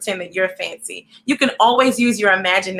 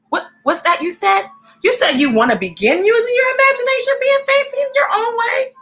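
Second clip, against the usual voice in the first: mock surprise; 12 semitones higher than usual. Pretending to hear viewers' reply.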